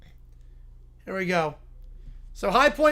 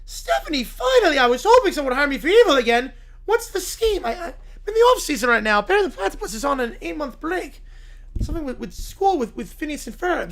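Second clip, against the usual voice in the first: high, fast excited mad scientist; Doofenshmirtz voice; 6 semitones higher, 4 dB louder than usual. Acted. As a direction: gush like an eager evil scientist. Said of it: "Doofenshmirtz Evil Incorporated".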